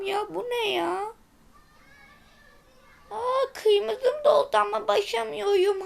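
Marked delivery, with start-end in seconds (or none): none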